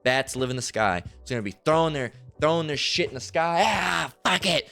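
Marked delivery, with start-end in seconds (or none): 3.6-4.7 high, slow, disgusted sigh of giving up; mock disgusted idol worshipper. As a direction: throw up your hands like a fed-up heathen.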